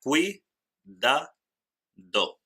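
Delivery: cuidado broken as cui... da... do, falling on do; syllable by syllable. Shown as a demonstration. Teacher counts out three syllables in cuidado, syllable by syllable. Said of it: "en total tenemos tres sílabas".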